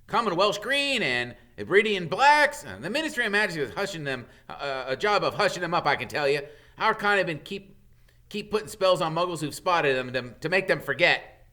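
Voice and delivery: a hint of an excited boy; boy showing friends the dragon books; lightly voiced Ron. A narrator's reading. Ron's voice, higher.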